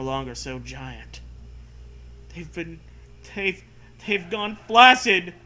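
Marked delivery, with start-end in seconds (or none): none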